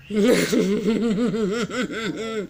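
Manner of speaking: deep, wavering demonic laugh. Acted. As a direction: cackle like a demon. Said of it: "la voix démoniaque, là, que je fais".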